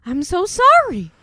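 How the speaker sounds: loud, high, slow mock apology; overeager apology. Lithgow character repeating his apology for the thrill, hammed-up.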